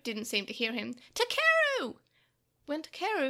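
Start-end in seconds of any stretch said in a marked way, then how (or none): 1.1-2.0 high, loud drawn-out call; distressed cry. A narrator's reading. Tokomon calls when Takeru does not hear, distressed shout. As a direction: call harder to get through. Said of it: "cried up to him in distress".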